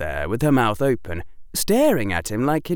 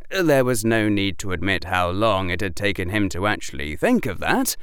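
ordinary delivery, nothing marked

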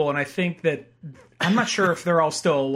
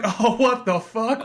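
drawn-out retold astonishment, pitched up; amazed disbelief; 5 semitones higher, slower than usual. Retelling a viewer's shock at the flamethrower, amazed disbelief. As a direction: react slowly, as if stunned by a wild twist.

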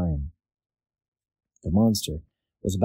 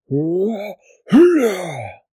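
monster cheer; 11 semitones higher, 7 dB louder, much slower than usual. Acted. Monster cheers after drinking pool.

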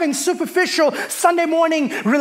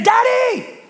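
"Daddy" said much higher and a bit louder, sliding down; daughter's high sing-song "Daddy"; 9 semitones higher, 3 dB louder than usual. Retold or imitated. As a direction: coax "Daddy" like a child about to admit trouble. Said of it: "nothing good is gonna come out of that".

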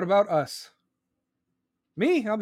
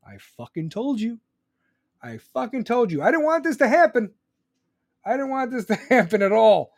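told-you rant; 5 semitones higher, 5 dB louder than usual. Pretend. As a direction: gloat as the bad guy, then yell it out. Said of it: "And be like".

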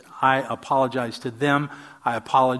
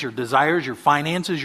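normal talking voice

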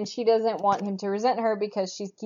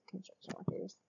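whispered; 19 dB quieter than usual. Real, not acted. Echoing each other, hushed.

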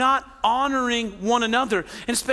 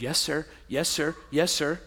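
robotic yes-man; 6 semitones lower, 6 dB quieter than usual. Retold or imitated. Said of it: "not a robot that just nods and goes".

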